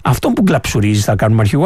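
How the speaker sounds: mock voter, low and dry; a low sarcastic voice of the people; lightly voiced ordinary voter; imitating the public. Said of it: "σου λέει".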